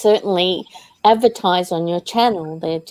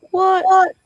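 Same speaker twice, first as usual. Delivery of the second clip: much higher, louder startled what; shocked disbelief; 10 semitones higher, 4 dB louder than usual. Retold or imitated. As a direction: gasp it out as if betrayed. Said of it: "It was just like".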